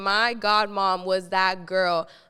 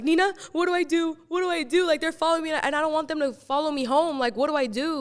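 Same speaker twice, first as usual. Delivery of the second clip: higher-pitched, mock panicked pleading; retold scared plea; 6 semitones higher, faster than usual. Storyteller replaying her call to godmom, mildly panicked. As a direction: relive a frightened call for help. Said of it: "And I'm all scared".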